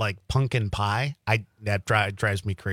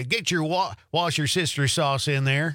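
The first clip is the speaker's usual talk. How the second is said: higher-pitched Southern cook impression; Southern accent; 5 semitones higher than usual. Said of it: "he kinda talks like he's from the South".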